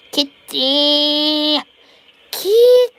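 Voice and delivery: squeaky, loud call to the kitty; high cat call. Real, not acted. Calling the cat, rising.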